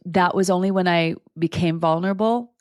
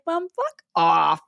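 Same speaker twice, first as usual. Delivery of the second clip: slow, dismissive brush-off; mock snap at mom; much slower than usual. Retelling how she told her mom off, slow and dismissive. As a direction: wave mom off, half joking. Annoyed retort to mom in story.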